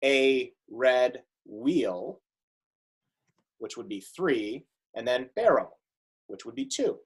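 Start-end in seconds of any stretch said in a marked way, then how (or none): 0.0-2.2 "a red wheel" very slow, every syllable set apart; counting the beats for the class, very slow. Shown as a demonstration. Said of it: "the next stanza".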